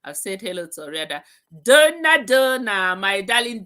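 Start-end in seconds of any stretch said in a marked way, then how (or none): 1.6-3.6 calling out loud. Real, not acted. Host calling member by name.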